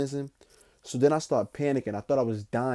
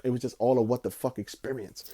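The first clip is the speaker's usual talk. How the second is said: ordinary delivery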